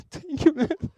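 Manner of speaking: very high, laughing, gushing thanks; delighted thanks. Retold or imitated. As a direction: squeal it through a laugh, re-enacting delight. Delighted reply to the driver's compliment.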